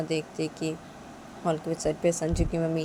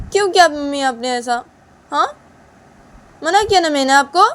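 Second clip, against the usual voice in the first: much higher, louder angry scolding; daughter-in-law furiously scolding mother-in-law; angry Uma; 11 semitones higher, 10 dB louder than usual. Acted. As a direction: shout at her for not listening.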